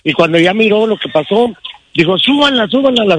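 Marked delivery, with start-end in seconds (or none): 2.2-3.0 retold urgent order, higher pitch; quick repeated command, pitched up. Caller retelling the comandante ordering his daughter into the truck, urgent.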